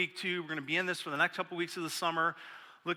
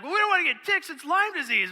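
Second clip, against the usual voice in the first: worried whine; 11 semitones higher, 5 dB louder than usual. Retold or imitated. Scared of ticks.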